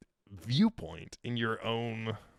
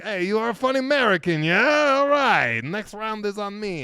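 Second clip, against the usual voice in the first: hammed-up hearty shout, high and loud; exaggerated merry bartender, loud then easing off; jovial French bartender; 10 semitones higher, 9 dB louder than usual. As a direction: boom it out like a delighted barman buying drinks. Retold jovial French bartender buys round.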